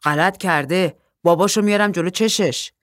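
loud, chest-out boast; puffed-up bravado. Acted. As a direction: brag like a tough guy defending the girl.

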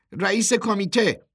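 high, loud, scared blurt; frightened answer. A narrator's reading. Frightened Jozie answers the man on the truck, scared. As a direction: blurt it out in fear. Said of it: "دوباره ترسیده بود".

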